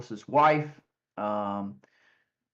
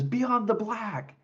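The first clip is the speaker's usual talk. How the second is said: put-on eager teen; 9 semitones higher, much slower than usual. Son lights up naming band.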